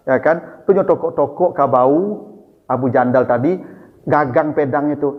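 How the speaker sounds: usual speaking voice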